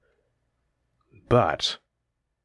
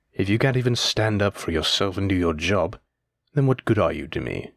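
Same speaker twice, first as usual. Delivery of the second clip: ordinary delivery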